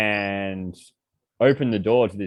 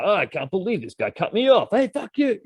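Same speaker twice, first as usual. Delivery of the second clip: road rage outburst; 10 semitones higher than usual. Retold or imitated. Driver cut off in traffic, rising angry yell. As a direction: pitch climbing into an angry yell. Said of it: "the guy's like".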